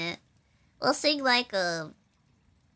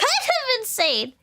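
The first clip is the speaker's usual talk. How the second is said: much higher and slower, giggling; squeaky laugh; 11 semitones higher, much slower than usual. Shown as a demonstration. Giggling at her own hobby obsession, squeaky and slow. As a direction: stretch it out high like a squeal. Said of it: "laughs, much higher and slower".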